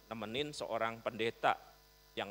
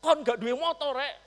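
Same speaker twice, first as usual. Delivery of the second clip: much higher, louder furious cursing; cursing in Surabaya slang; 13 semitones higher, 7 dB louder than usual. Retold or imitated. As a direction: spit the insult at the driver. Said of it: "langsung memaki dengan makian khas Surabaya". (makian marah dengan suara tinggi dan keras)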